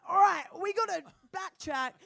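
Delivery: mock rallying shout. Lost hiker rallying himself.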